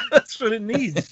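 high-pitched, drawn-out laughing protest; laughing retort. Real, not acted. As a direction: burst out laughing, then squeal the answer. Amused retort to co-host's teasing.

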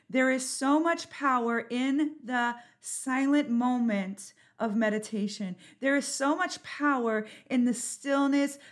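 slow, swelling preacher voice; preaching. Acted. Preaching on meditation's power.

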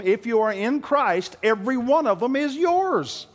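high, loud preaching building to a shout; preaching at full force. Acted. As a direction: drive it home to the congregation. Preaching climax.

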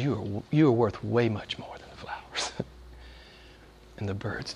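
low, very soft voice; almost whispered, as Jesus comforting; tender assurance. Acted. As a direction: drop to a hushed, intimate reassurance. Jesus' tender words, voiced softly.

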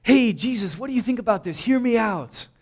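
acted-out eager appeal; eager pitch. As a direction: pitch your idea keenly, voice lifted. Acting out an eager disciple's pitch.

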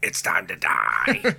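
low, slow demon rasp; demonic voice. Retold or imitated. Host quoting the possessed sons, demonic voice. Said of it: "speak in a demonic voice".

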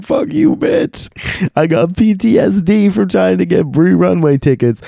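very high, mock-hysterical squeal; hammed-up, high-pitched indignation; outraged outburst. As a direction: shriek it in comic outrage. Retold outburst at announcement.

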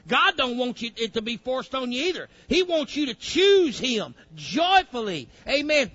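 ordinary talk

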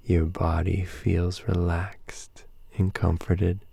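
slow, hushed breathing cues; calm meditative tone. Acted. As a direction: ease them gently into sleep.

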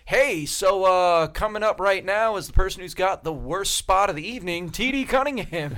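mock emcee bringing on the next act; imitated show host. Comedy host introducing the next comic, mock-announcer voice.

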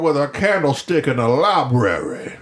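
hammed-up Georgian sleuth; put-on Southern Georgian detective voice; Georgian accent. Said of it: "that weird Georgian accent".

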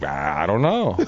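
low, lost and sheepish; clueless mumble. Retold or imitated. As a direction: angler answering his marshal, sheepishly clueless. Clueless answer to marshal.

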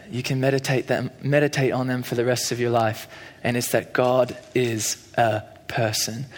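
put-on soft, unhurried British accent, slowing at the end; lightly imitated British accent, hushed and meaningful; a hushed British accent delivering a life-changing line; [name]'s British accent. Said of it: "in his British accent".

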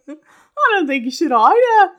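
loud, high, hammed-up bad Irish accent; failed Irish accent. Shown as a demonstration. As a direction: botch an Irish accent at full volume. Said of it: "I told you I can't do it".

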